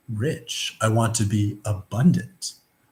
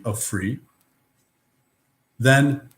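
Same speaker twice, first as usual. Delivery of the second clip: ordinary delivery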